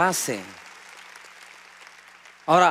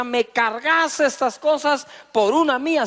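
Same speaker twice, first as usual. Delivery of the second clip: high, loud, slow order from a soldier; bossy Roman; 8 semitones higher, 5 dB louder than usual. Retold or imitated. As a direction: command the load, slow and loud. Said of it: "un romano le decía".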